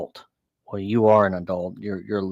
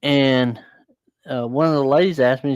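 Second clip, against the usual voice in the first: usual speaking voice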